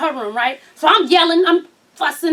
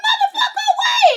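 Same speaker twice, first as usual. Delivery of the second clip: laughing yell. Real, not acted. Punchline about husband.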